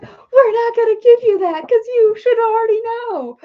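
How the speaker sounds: high, loud, whiny spirit voice; squeaky mock-scolding spirit voice; voicing Spirit. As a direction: play the bratty spirits scolding her. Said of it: "And they're like".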